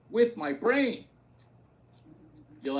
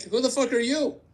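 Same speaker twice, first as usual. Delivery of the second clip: mock outraged retort; 8 semitones higher, 5 dB louder than usual. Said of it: "I'm like".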